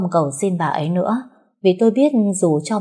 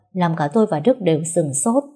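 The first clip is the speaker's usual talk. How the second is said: ordinary delivery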